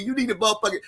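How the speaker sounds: ordinary talk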